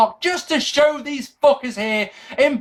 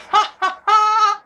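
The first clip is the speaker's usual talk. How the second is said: high, sharp cackling laugh. Acted. Reviewer mocking the network's excuse, high laugh. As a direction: let out a high ha-ha-ha. Laughs at technical difficulties line.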